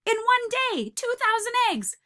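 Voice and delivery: high-pitched amazement; astonished, high and excited; amazed. Acted. Amazed at the egg count.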